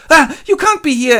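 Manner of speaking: very high, loud yelp; startled Lizzie. Retold or imitated. Lizzie startled by Darcy, very high and loud. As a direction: yelp in shock. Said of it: "she goes".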